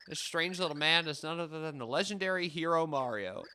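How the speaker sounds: normal talking voice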